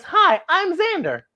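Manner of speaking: put-on a bright little boy introducing himself; Xander greeting. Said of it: "And Xander says".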